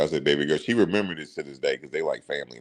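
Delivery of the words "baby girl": ordinary talk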